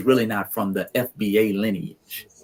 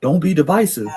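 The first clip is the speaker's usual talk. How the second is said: mocking impression; 7 semitones higher, 6 dB louder than usual. Mock voice continues.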